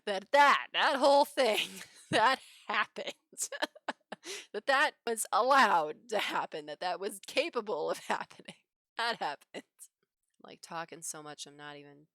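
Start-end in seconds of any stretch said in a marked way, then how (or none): 0.0-9.4 loud, high start breaking into real laughter; real giggles breaking up the words; laughing disbelief. Real, not acted. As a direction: crack up at your own story. Cracking up at own story.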